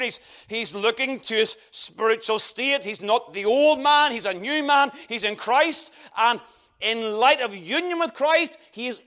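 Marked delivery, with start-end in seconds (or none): none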